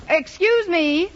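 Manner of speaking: high, slow attention-getting call; calling out politely. Acted. Miss Brooks hailing a bus driver for directions, calling out politely. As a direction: stretch it out to catch a stranger's attention.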